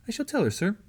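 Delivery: put-on high, polite servant girl voice; eager girl promising sir; young girl voice. Servant girl answers soldier.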